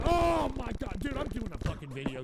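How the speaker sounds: usual speaking voice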